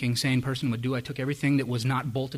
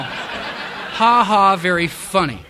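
sarcastic laugh; 6 semitones higher, 9 dB louder, much slower than usual. Retold or imitated. Sarcastic reaction to mom's trick.

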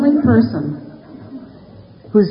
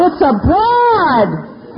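much higher, very slow, hammed-up disbelief; incredulous cop; 10 semitones higher, much slower than usual. Cop telling his partner the driver's a woman, hammed-up disbelief. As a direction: stretch it out like he can't believe it. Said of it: "He said".

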